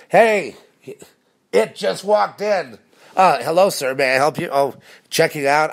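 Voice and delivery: high, loud, fake-sweet clerk voice; mock clerk greeting. Mocking the supermarket clerks who watch him. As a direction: sneer it like a phony cashier.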